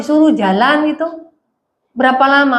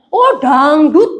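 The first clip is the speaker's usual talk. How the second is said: Surabaya drawl; 3 dB louder, much slower than usual. Retold or imitated. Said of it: "Bahasa Surabaya kan suka".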